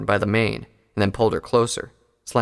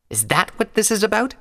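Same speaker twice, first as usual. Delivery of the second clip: higher, sharper mare voice; Pyre's voice; 12 semitones higher than usual. A narrator's reading. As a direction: voice her as a scornful prisoner. Pyre voiced by narrator.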